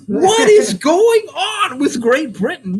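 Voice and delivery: high, slow, wailing mock disbelief; hammed-up exasperation at a whole country; mock outrage. Host mocking British crab cake critics, hammed-up high wail.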